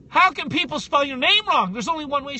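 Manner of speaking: loud, high indignant retort; upset retort. Retold or imitated. Guest retells snapping at the interviewer, loud and indignant. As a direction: blurt it out in disbelief. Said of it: "I got so upset 'cause I'm like".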